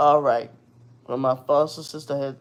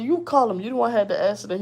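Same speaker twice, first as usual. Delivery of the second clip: high, fast, exasperated snapback; heated retort; 7 semitones higher, faster than usual. Retold or imitated. Arguing with the boyfriend over the insurance call, heated and high. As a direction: throw it right back at him.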